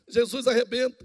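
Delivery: ordinary talk, nothing marked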